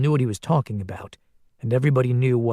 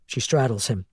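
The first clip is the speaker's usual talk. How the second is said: ordinary delivery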